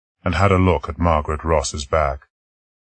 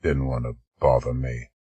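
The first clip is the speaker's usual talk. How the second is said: grim; 6 semitones lower, 7 dB quieter than usual. Acted. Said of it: "I thought grimly".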